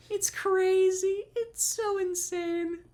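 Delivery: very high, drawn-out squeal of disbelief; stretched, sky-high exasperated amazement; incredulous squeal. Real, not acted. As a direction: squeak it out slowly, overcome by the absurdity. Overwhelmed by the absurdity.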